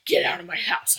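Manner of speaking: breathy whispered mock line; hushed imitation of the mean line; whispered quote. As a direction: whisper the cruel words. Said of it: "you were like".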